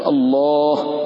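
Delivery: الله with dark, full laam and rounded long vowel; heavy lam. Shown as a demonstration. Teacher models the heavy letter, الله with dark laam and alif. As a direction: hold a thick, rounded aw-like aa in الله. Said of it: "فتفخم".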